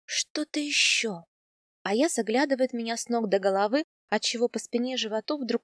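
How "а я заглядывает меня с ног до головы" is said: plain reading voice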